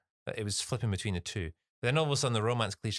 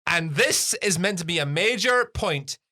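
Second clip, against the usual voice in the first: exasperated; 6 semitones higher, 8 dB louder than usual. Real, not acted. Exasperated emphasis.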